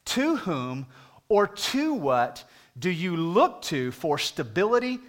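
ordinary talk

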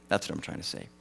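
unvoiced muttered aside. Real, not acted. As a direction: drop away into a mumble. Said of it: "self-aside after rambling".

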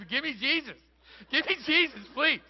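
put-on high, loud panicked kid pleading; frantic mock begging. Scared camp kid begs to be saved, mock desperation. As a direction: beg desperately, hammed-up and shouted. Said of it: "all the kids are like".

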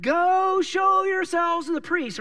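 loud, high, slow shouted command; yelling back. Retold or imitated. Jesus yelling back to the lepers from afar, loud and slow. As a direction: call it back over the crowd. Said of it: "And he yells back".

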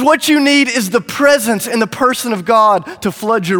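urgent appeal to a crowd; impassioned proclamation. Acted. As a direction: call it out with conviction. Jesus calls out over the crowd.

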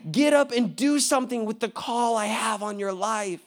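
urgent wake-up call. Retold or imitated. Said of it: "God wants to wake the church up and say".

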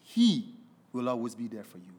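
quiet, low, sincere promise; soft reassurance. Acted. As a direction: reassure them gently. Sermon promise.

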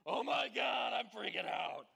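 loud, high panicked yell; hammed-up terrified outburst; mock panic. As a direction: shout like you're dangling over fifty floors. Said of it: "and I'm like".

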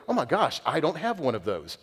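high, gushing mock surprise; mock amazement. Preacher imagining God receiving something new, mock amazed.

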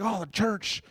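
high, sneering mock complaint; mocking church basher. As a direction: parody a Facebook hypocrite-basher. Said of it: "not through Facebook and bashing".

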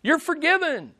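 hammed-up shouting of the pardon; mock shouted pardon. Preacher mocking a showy pardon from the cross, high mock shout. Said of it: "he's not shouting out to them".

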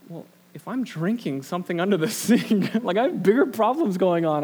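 retold inner thought, laughing at the pitch; amused thought. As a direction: chuckle through the thought. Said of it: "I thought".

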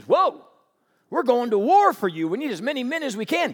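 loud, high startled objection; startled protest. Acted. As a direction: shout back in disbelief. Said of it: "Gideon says".

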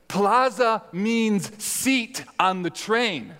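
slow, high-pitched mock Spanish accent; snarky Spanish accent. Spanish woman correcting a lost tourist, snarky Spanish accent. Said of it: "rather in a snarky tone".

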